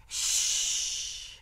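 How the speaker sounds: long, loud hush; forceful shushing. A narrator's reading. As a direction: shush someone who is too noisy. Rat hushes loud Mig.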